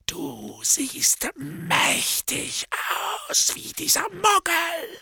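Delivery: high, cracked mad voice; a deranged drunken hermit; crazed drunk. Acted. Morphin's voice.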